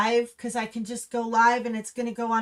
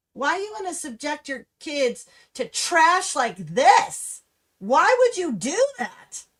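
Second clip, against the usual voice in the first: higher and louder, demanding why; rising real fury at the parents; real anger; 4 semitones higher, 5 dB louder than usual. Real, not acted. Vlogger berating parents who brought kids, angry and loud. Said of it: "I'm getting so mad".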